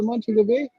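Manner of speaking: usual speaking voice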